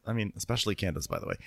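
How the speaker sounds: normal talking voice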